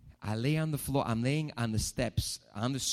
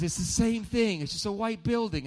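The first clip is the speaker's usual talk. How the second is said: put-on stubborn old man, higher and louder; high mock dad voice; 11 semitones higher, 8 dB louder than usual. Preacher imitating his father insisting on the building, high and loud.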